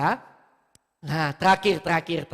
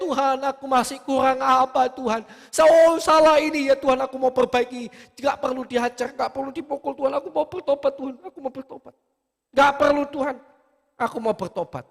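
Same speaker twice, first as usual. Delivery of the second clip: high, loud begging plea to God; a sinner pleading not to be punished; pleading repentant prayer; 8 semitones higher, 6 dB louder than usual. Acted. (doa memohon pertobatan, tinggi dan keras)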